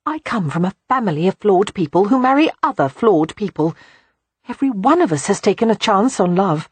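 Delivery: anxious tumble. A narrator's reading. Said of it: "in an anxious tumble of words".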